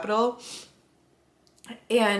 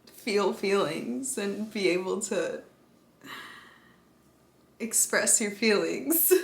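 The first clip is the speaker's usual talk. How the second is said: ordinary delivery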